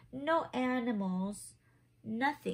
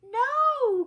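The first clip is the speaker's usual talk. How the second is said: high, loud desperate cry; cry of alarm; 14 semitones higher, 11 dB louder than usual. Acted. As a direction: shout it as your friend is snatched away. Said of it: "cried Luca".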